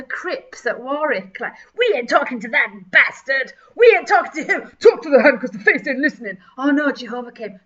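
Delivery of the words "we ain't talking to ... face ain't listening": hammed-up angry shout, louder; mock gang bluster. Builders refusing to talk to each other, mock angry shout. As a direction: bark it like a sulky gang member. Acting rival gang members.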